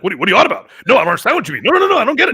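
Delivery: imitating his own irritated protest, pitch climbing high; mock mad. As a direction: protest in rising, half-comic irritation. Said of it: "I start getting really mad".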